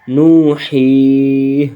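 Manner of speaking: نو، ہی، drawn out slowly in Quran recitation style; waqf stop reading. Shown as a demonstration. Teacher shows pupils the waqf reading, slow and drawn. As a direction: stretch نو، ہی، slowly, teacher demonstrating a pausal reading. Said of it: "وقفاً".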